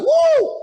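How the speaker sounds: loud, shooting up very high; excited whoop. Retold or imitated. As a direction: whoop in amazement at the singing. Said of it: "So we was like".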